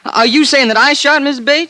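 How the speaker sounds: shocked disbelief. Acted. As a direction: recoil at the accusation. Accused of murder, shocked.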